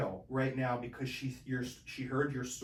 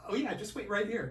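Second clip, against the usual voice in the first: friendly cop; 8 semitones higher than usual. Retold or imitated. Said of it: "he's like".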